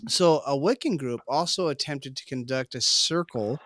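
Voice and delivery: ordinary talk, nothing marked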